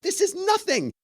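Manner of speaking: high, slow, breezy dismissal; unfazed producer. Retold or imitated. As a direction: wave it all away like nothing matters. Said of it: "And he goes".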